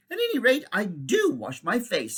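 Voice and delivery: a snooty little rebuke; prim girl's scold. Acted. Girl scolds grubby boy.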